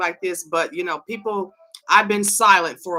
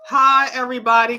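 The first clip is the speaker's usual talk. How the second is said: higher, louder, quick hello to everyone; loud greeting; 6 semitones higher, 7 dB louder, faster than usual. Real, not acted. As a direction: boom the hello out to the room. Greeting viewers twice.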